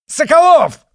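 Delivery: screamed, very high and loud; yelling. Acted. Izotov yelling for Sokolov to be his second. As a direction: yell his name across the room. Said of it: "заорал Изотов".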